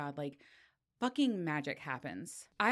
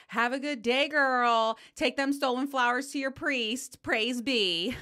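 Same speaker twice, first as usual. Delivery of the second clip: louder, raised-pitch parent's goodbye; mock cheery send-off; 6 semitones higher, 5 dB louder than usual. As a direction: call it out to the kid at drop-off. Acted send-off at school drop-off.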